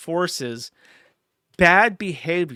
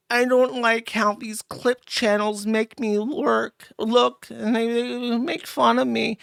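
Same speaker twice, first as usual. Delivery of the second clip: mock whiny complainer; 5 semitones higher, slower than usual. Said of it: "Low-tier God somehow has the pull".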